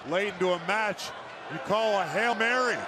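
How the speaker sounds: usual speaking voice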